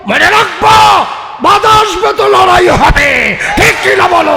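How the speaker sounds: high, roaring rally shout; full-force preaching. Real, not acted. Preacher warning those who disgrace imams, high full-force shouting. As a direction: shout it out like a battle cry.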